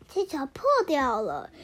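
usual speaking voice

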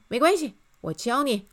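high, loud encouraging lift; cheery reassurance. A narrator's reading. Miss Optimist offers to teach the king, cheerily. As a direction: reassure him brightly.

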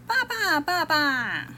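put-on very high child's call for dad; excited little-kid shout; children calling out. A narrator's reading. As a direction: call from a distance, high and sliding down. Returning children call their fathers.

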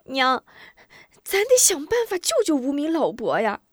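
put-on high pleading child voice; urgent boy. Boy begs mother to save the old man, high urgency. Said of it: "急道".

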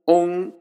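オン as an o with sound let through the nose; オン, o closing into a nasal hum; nasalised vowel. Shown as a demonstration. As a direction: demonstrate オン slowly as one nasal syllable. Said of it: "少し鼻音も入れると".

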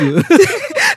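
high rising burst of laughter. Real, not acted. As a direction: crack up mid-story. Laughing while retelling.

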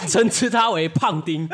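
slow, laughing naming; storyteller amused by own joke. Real, not acted. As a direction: deliver the nickname cracking up. Teller laughs at nickname.

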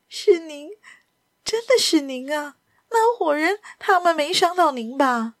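high, faltering woman's voice; broken halting speech. Acted. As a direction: gasp the words out in pieces. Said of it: "巴赫夫人断断续续地说".

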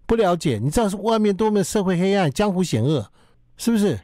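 high, nagging parent voice; nagging mom lecture. Retold or imitated. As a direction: scold like a worried mother. (唠叨妈妈训话)

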